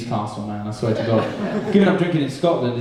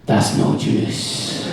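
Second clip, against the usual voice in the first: slow Glaswegian growl of disgust; Glaswegian girlfriend; much slower than usual. Acted. Hungover girlfriend rejecting the juice, slow Glaswegian menace. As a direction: draw it out in a harsh Glaswegian accent. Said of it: "had a Glaswegian girlfriend".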